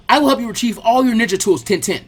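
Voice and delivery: loud eager. Acted. Lee rigid salute.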